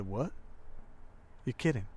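hushed, halting "what, you're kidding"; quiet, understated shock; stunned disbelief. Retold or imitated. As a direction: softly retell being caught off guard, with a pause after the question. Retold stunned reaction to pregnancy news.

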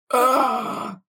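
loud, high groan of amazement; hammed-up revelation groan; mock overwhelmed groan. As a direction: let out a stunned groan. Peter's revelation reaction.

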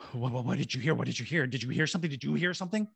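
urgent stammered questions, climbing in pitch; frantic questioning. Retold or imitated. Shaken man quizzing cops after fleeing, frantic questioning. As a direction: re-enact a panicked demand for confirmation.

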